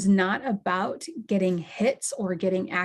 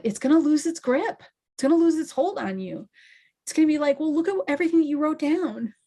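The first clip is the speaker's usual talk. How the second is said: excited; 8 semitones higher than usual. Real, not acted. Emphatic encouragement, raised pitch.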